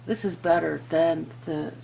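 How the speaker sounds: ordinary talk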